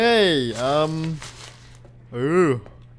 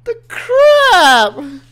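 terrified scream; 13 dB louder than usual. Real, not acted. Still fleeing the ghost.

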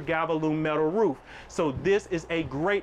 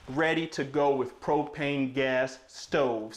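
normal talking voice

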